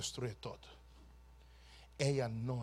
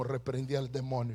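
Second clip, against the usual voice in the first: ordinary delivery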